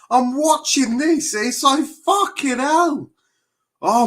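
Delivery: high, loud annoyed ranting; heated rant. Real, not acted. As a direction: keep the anger boiling.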